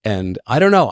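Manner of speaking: normal talking voice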